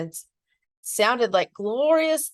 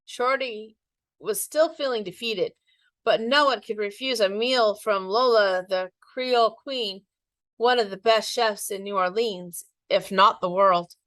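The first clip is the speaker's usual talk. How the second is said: ordinary delivery, nothing marked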